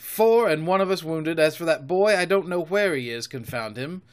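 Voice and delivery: storybook sailor's irritated report; irritated pirate. Acted. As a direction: voice a fed-up pirate reporting losses. Retold pirate speech in story.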